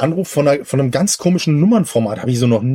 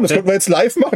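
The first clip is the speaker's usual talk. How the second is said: normal talking voice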